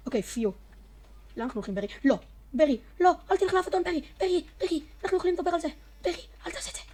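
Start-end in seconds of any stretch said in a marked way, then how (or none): 2.0-5.7 high, loud hammed-up begging, fast at the end; mock pleading. Player begging Berry not to leave, hammed-up panic. 6.0-6.8 hammed-up high sob; mock weep it to Berry; fake crying. Player fake-crying as Berry is lost, hammed-up sob.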